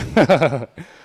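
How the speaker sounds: loud, high amused laugh. Real, not acted. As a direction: laugh at the story. Laughs after the quote.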